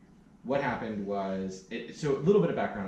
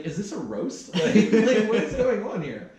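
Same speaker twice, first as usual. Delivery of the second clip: high and loud, laughing through the words; laughing disbelief; 12 semitones higher, 11 dB louder, slower than usual. Retold or imitated. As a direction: laugh at how absurd the questions were. Retelling reaction, laughing through it.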